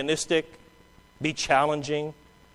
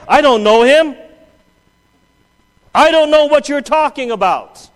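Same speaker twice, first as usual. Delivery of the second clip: shouted, high denial; loud denial; 10 semitones higher, 11 dB louder than usual. Acted. Preacher voicing Peter, shouting his denial loud and high. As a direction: Peter yelling that he never knew him.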